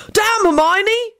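high, drawn-out cry of protest; high-pitched storytelling yell. A narrator's reading. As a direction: yell it high without much extra volume. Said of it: "George yelled out".

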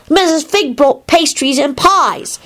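put-on slow, high little-kid announcement; delighted child. A narrator's reading. Teddy informs Kingsley about treats, delighted. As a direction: savour it in a high, delighted kiddie voice. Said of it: "Teddy informed Kingsley delightedly".

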